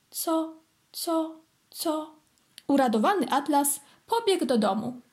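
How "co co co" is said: 희미해지는 메아리; softer, fading echo. A narrator's reading. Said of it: "echo repeats".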